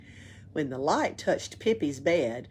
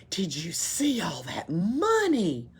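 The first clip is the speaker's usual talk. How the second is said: greedy whisper; slower than usual. Acted.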